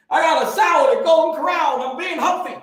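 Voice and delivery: lightly voiced smug diner; hammed-up self-satisfied diner, high and loud; mock salad eater.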